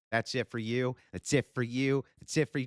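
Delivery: gleeful whack-a-mole taunt; mock dismissals.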